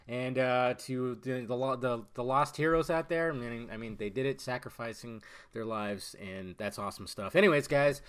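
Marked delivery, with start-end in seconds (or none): none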